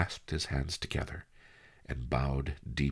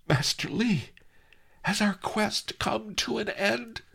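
excited cry. Acted. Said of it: "I cried".

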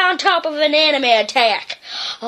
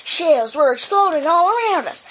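plain reading voice

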